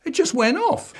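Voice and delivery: put-on high whiny kid voice; boy pleading innocence to his dad; child voice. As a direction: squeak it out like a guilty little boy. The refrain again.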